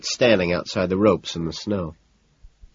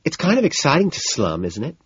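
lit up; 7 semitones higher than usual. A narrator's reading. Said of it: "lighting up".

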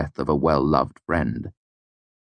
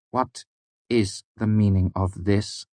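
slow stern question; 4 semitones higher than usual. A narrator's reading. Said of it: "the mayor asked slowly".

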